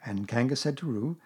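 plain reading voice

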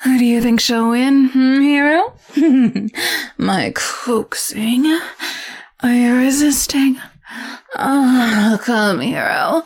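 menacing temptress toying with her captive; sultry seductress. Acted. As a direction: purr each threat like a caress. Seductive captor teases hero.

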